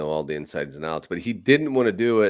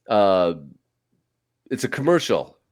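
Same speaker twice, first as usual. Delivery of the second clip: ordinary delivery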